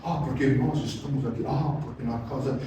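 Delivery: low, soft pious mumble; mock evangelical affectation; churchy put-on voice. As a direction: parody a preacher's holy voice. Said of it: "Ele muda a voz".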